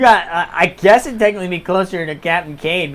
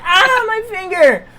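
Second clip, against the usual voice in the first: high, loud, drawn-out yelp; hammed-up wail over a hurt finger; pained cry; 12 semitones higher, 6 dB louder, slower than usual. Seamstress hurt while furiously sewing, acted pained cry.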